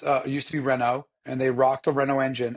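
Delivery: ordinary delivery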